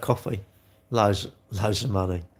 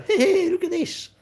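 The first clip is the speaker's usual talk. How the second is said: much higher, laughing mock kid voice; mocking a troll kid; 3 dB louder than usual. Streamer mimics a troll viewer with a high, giggly voice. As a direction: play the silly show-off kid with a giggle. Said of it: "When I go to school, I go".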